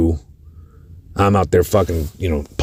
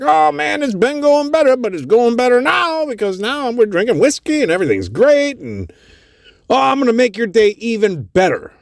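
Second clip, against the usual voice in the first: much higher, happy rambling voice; cheerful homeless man. Retold or imitated. Homeless man telling the band his life got better, happily. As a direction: sound blissfully buzzed on free whiskey.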